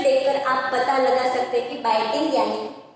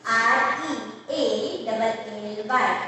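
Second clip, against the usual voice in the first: slow spelling; 2 semitones lower, slower than usual. Shown as a demonstration. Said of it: "next word is".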